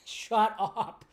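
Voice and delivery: giggling "Shut up" at the fans; laughing shut up. Real, not acted. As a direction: laugh through "Shut up" in disbelief.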